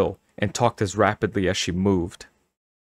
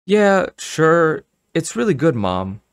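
higher, louder placating answer; hasty reassurance; 8 semitones higher, 5 dB louder, slower than usual. A narrator's reading. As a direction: reassure her, pitched up and eager. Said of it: "Carly Beth answered quickly".

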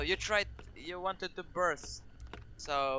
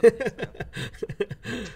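mock dramatic announcement; 4 semitones higher, 8 dB louder than usual. Joking ban announcement.

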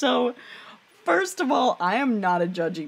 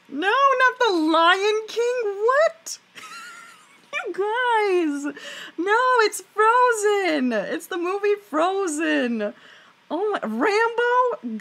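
exasperated disbelief; 12 semitones higher, 4 dB louder, slower than usual. Real, not acted. Chat guesses the wrong movie.

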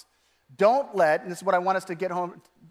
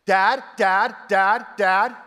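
loud, insistent repeated calling; nagging child; 6 dB louder, much slower than usual. Retold or imitated. Child badgering Dad for attention, nagging. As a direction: pester Dad with identical rising calls. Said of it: "anyone nag you".

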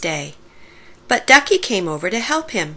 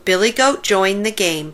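plain reading voice

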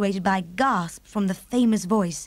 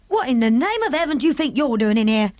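louder, indignant maid's shout; angry shout; 4 semitones higher, 5 dB louder than usual. Acted. Maid shouting at the girl on the windowsill, angry and louder. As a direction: throw the intruder out. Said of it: "she shouted angrily to Sophie".

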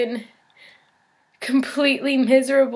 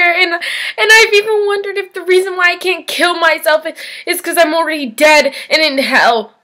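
high, loud cry building to a scream; anguished outburst; 9 semitones higher, 10 dB louder than usual. Acted. Patient declares their life a living hell, anguished and screaming. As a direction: build from desperate cry to full-out screamed despair.

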